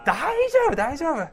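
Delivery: high-pitched, loud mock brush-off; breezy reassurance. Retold brushing off wife's warning. (軽く受け流す高い声で)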